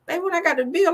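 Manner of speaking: usual speaking voice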